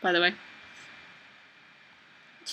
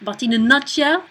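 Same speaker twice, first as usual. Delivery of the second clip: higher, put-on French accent, rising at the end; 4 semitones higher, 4 dB louder than usual. Shown as a demonstration. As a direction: attempt a French-speaker's accent. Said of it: "so we'll do that accent".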